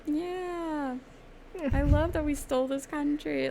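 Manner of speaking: high, sneering sarcastic put-on; mock the hypocrites. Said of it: "being like".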